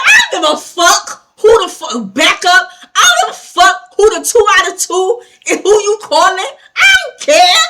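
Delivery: high, loud, furious rant, re-enacted; explosive mock-furious shouting; losing it. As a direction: yell over the officer, climbing at the end. Retold losing it at officer.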